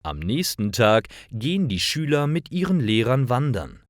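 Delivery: ordinary delivery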